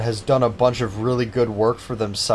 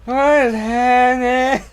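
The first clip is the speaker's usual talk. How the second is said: drawn out, much higher, baffled; bewildered; 13 semitones higher, 5 dB louder, much slower than usual. Real, not acted. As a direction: stretch it out in disbelief. Bizarre game moment.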